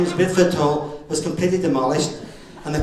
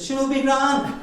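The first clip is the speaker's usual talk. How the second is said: breezy reassurance; 9 semitones higher, slower than usual. Retold or imitated. Young driver brushing off worry.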